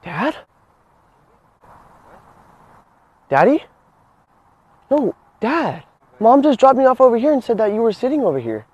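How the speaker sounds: lightly voiced child seeking his dad; pretend lost son. Prankster poses as stranger's lost son.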